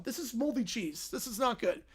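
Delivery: ordinary talk, nothing marked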